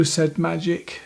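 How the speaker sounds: ordinary delivery, nothing marked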